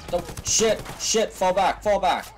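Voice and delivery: urgent high yell to retreat; frantic retreat order. Real, not acted. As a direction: shout it, panicked, pulling back. Ambushed, ordering squad back.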